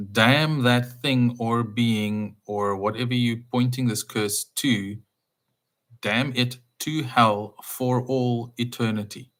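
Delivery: slow, weighty, every word placed; solemn slow curse. Shown as a demonstration. Preacher to listeners, reciting the full curse slowly and gravely. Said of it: "the full curse is".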